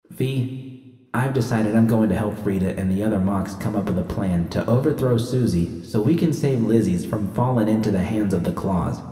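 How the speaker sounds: hushed but excited, building the dream; determined Judy. Acted. Judy lays out her plan.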